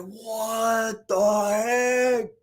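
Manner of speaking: very slow, drawn-out recalled amazement; slow-motion mock shock; stunned disbelief. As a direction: stretch each word in retold disbelief. Reacting to the mother's scolding.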